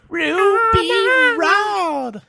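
high squeal, Ruby Road; Chris Tucker impression. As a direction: shriek it like Ruby Rhod. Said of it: "where he's like".

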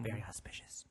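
breathy whispered aside. Real, not acted. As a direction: whisper it under your breath. Aside while friend describes apartment.

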